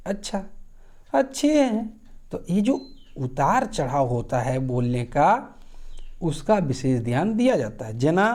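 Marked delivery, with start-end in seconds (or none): 0.1-1.9 अच्छे हैं said with a sharp high rise; a lilting Angika अच्छा; rising tune on achchha. Shown as a demonstration. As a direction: swing the pitch up on अच्छा. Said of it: "जैसे बोलते अच्छा".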